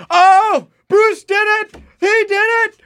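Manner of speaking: high, loud ecstatic yelling; roommate shouting in joy. Retold or imitated. College roommate screaming at the TV over the home run, ecstatic. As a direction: shriek it like your team just clinched. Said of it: "and I hear".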